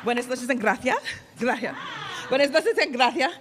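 put-on thick Spanish accent, rolled and lisped; hammed-up Spanish accent. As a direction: purr it like a Latin beauty queen. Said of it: "I am Miss Florida".

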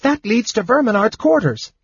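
high-pitched alarmed warning; urgent warning. A narrator's reading. Kender warning companion off the stairs, alarmed. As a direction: warn him urgently in a high voice. Tas warns Seston off stairs.

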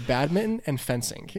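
ordinary talk, nothing marked

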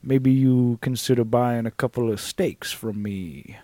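put-on Southern trailer boss pitching stakes to campers, Southern accent. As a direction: play a Southern camp boss sizing them up. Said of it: "southern accents, giving orders".

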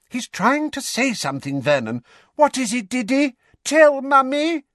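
high, coaxing Aunt Petunia voice; doting mother. A narrator's reading. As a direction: coax the boy, high and anxious.

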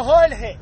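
"Jorge" said as Hol-hey. Shown as a demonstration. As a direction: put an L in place of the R in "Jorge". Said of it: "We change them shits for fucking L's".

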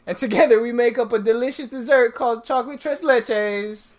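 booming circus ringmaster announcing; loud, sing-song showman introduction. Pretend. Ringmaster style show intro.

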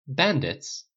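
baffled, falling repeat; thrown. A narrator's reading. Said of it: "Dale said aloud, completely thrown now".

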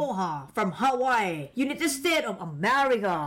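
drawn-out, put-on American-style English greeting; put-on English accent. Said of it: "อันนี้สำเนียงไงนะ". (สำเนียงฝรั่งแบบแกล้งทำ)

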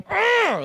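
high, loud exasperated argh; hammed-up growl of annoyance; frustrated groan. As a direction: groan like a chore has ruined your game. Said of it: "Like, so frustrated".

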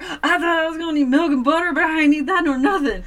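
high, loud gleeful gush; excited. Retold or imitated. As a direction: gush like a kid who got a treat. Gleeful quote to friend.

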